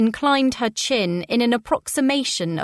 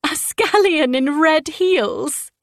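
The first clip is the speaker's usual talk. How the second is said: laughing; 7 semitones higher, 3 dB louder, slower than usual. A narrator's reading. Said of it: "Daisy choked with laughter".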